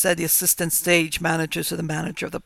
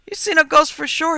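high, loud, earnest country farmer; solemn insistence; 10 semitones higher, 8 dB louder than usual. A narrator's reading. Farmer telling the motorist what he saw, solemn. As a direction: insist on it with grave certainty. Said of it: "he rejoined solemnly".

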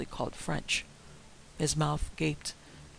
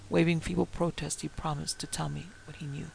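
ordinary delivery

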